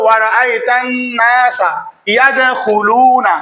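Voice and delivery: slow recitation with nasal hum held on নাসা; ghunnah shown. Shown as a demonstration. Teacher demonstrating ghunnah to students. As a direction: hold the ghunnah on নাসা slowly. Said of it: "ওয়াজিবে গুনাহ".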